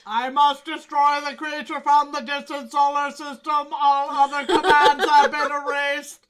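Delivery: booming robotic villain. Pretend. Voicing the robot's lines.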